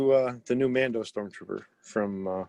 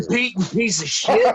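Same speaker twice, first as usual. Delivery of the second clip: mock outrage; 10 semitones higher, 6 dB louder than usual. Real, not acted. Insulting the robot.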